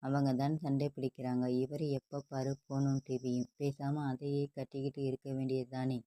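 ordinary delivery

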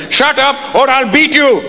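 high, slow mock-angry dad threat; put-on dad warning his kid off; threatening father. Dad threatens interrupting son, hammed-up anger.

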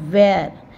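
teacher demo of the word; pronouncing wear. Shown as a demonstration. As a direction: model wear clearly. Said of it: "यॉ का sound हम थोड़ा कम बोलेंगे".

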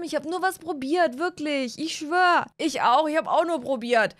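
ordinary talk

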